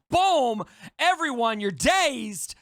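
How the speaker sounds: loud, high, punched-out proclamation; trump-card announcement. Acted. Co-host voices the character playing his trump card, booming proclamation. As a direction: burst in and order everyone out. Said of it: "comes in and plays and says".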